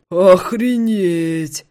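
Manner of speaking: very slow, loud shocked exclaim. Acted. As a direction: stretch it out in shock. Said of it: "в шоке восклицает Виктор".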